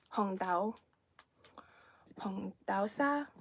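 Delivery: "Hong dao sa" slowly, each syllable in Cantonese with a rise on sa; Cantonese pronunciation. Shown as a demonstration. Repeating the Cantonese name for viewers, slow and careful. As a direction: spell it out in Cantonese, unhurried. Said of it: "as we call it in Cantonese".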